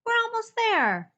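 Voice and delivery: high, loud reassurance shouted; anxious call. Acted. Rescuer calling to Jubal that they're almost there, loud. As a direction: shout that help is near. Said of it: "an anxious voice called".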